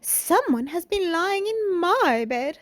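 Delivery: high, slow mother bear; middle-sized bear voice. A narrator's reading. Middle-sized bear looking at her bed, high-voiced complaint. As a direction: lift it high and stretch it out. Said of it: "said in her middle-sized voice".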